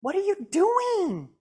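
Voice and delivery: high, slow exasperated cry; mock road-rage question; annoyed at tailgater. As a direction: call out at the driver behind. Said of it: "And I'm like".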